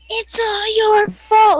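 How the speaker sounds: high, loud, slow yell; retold yell blaming her brother; yelling through window. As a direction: scream it at him while banging the glass. Banging on car window.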